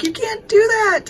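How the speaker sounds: put-on sharp high scolding cry at a child; mother's frantic yell at her runaway toddler; retold yell. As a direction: yell it at her like she scared you to death. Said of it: "yelling at her".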